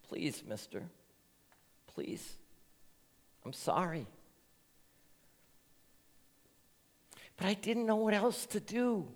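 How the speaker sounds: a hint of a scared boy pleading. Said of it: "he pleaded".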